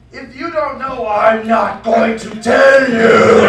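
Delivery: rising into a loud slow shout; slow and furious, yell the last words; girlfriend snapping. Acted. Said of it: "And then she said".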